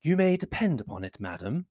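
soft, chilly politeness; be coolly polite to an unwelcome guest; hushed, icily courteous reply; cold civility. A narrator's reading. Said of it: "with cold civility".

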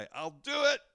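high, loud, overeager pledge; confident bravado. Retold or imitated. Peter eagerly promising the Lord, with confident bravado. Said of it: "Peter's confident bravado".

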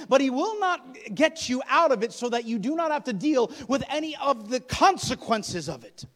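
loud, high, emphatic preacher warning; forceful preaching. Real, not acted.

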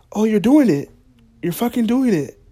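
imitating a thrilled tourist; mock excited stranger. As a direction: gawk like you spotted a celebrity. Polish family spotting him eat.

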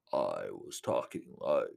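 very deep, slow, soft villain voice; Darth Vader. Shown as a demonstration. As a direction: sink down deep and draw it out slowly. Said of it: "I tried to do a Darth Vader voice".

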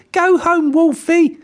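high, loud teasing pig voice; cheeky little pig. Acted. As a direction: send him packing with a grin. Pig mocking wolf.

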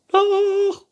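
very high, loud retort; princess calling out. A narrator's reading. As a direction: shriek back stubbornly as princess. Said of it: "rief die Prinzessin". (trotziger, hoher Prinzessinnenschrei)

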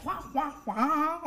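mock Chinese. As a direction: imitate Chinese with nonsense syllables. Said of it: "heard somebody speaking Chinese".

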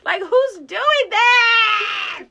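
high, slow words broken by laughter; laughing shout. Real, not acted. Mocking the business-card guy with her friend, a laughing shout. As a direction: cry it out while cracking up.

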